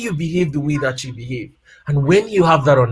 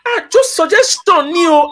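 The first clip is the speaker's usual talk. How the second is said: high, sheepish defensive reply; sheepish backpedal; 12 semitones higher, 5 dB louder than usual. Retold or imitated. Husband placating his upset wife, high and sheepish. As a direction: shrug it off as just an idea. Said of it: "I was like".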